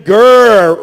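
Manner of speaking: normal talking voice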